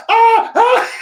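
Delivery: high, loud imitated scream of the wife; husband imitating his wife screaming at the fake blood; mock scream. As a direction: screech in a mock panic. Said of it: "she starts screaming".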